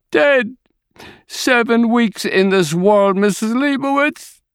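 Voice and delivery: very high anguished scream, slowing down. A narrator's reading. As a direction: wail the loss. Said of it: "My mother screams again".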